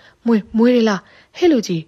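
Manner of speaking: frightened shout. A narrator's reading. Thief spots the snakes and yells at the old man, frightened shout. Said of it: "အဲ့လိုအော်ဟစ်လိုက်တော့တယ်".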